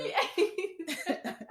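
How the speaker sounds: high, loud, drawn-out praise through laughs; laughing. Real, not acted. Co-host still praising the tweet, laughing. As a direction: slowly savour it while laughing.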